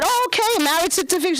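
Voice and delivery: high, chirpy clerk voice; DMV clerk voice. Retold or imitated. DMV clerk chirping at the comedian. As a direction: play the perky clerk.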